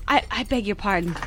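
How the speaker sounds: soft, stammered apology; flustered, quiet sorry; timid apology. Acted. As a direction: shrink back and apologise softly.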